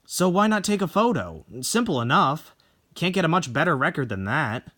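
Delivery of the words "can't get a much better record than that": plain reading voice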